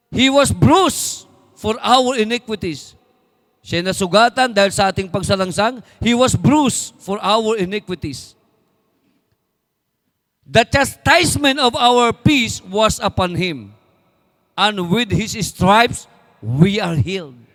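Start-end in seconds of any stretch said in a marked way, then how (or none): 0.1-2.9 slow, solemn Bible reading; scripture reading. Acted. Quotes scripture slowly. 6.0-13.7 slow, solemn Bible reading; scripture reading. Acted. Quotes scripture slowly. 14.5-17.4 slow, measured verse reading, fading at the end; solemn reading. Acted.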